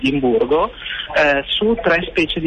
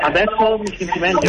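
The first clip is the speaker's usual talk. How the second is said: echo on the line; 3 semitones higher than usual. Real, not acted. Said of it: "No, sempre con eco".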